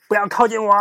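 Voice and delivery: high, loud fierce warning; high, loud harsh warning; sharp warning. Acted. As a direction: shout them back sharply. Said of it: "厉声警告".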